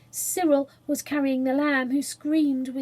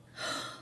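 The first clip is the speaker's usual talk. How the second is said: gasp; 11 dB quieter than usual. Acted. Listener gasps at praise.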